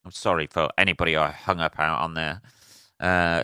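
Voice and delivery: usual speaking voice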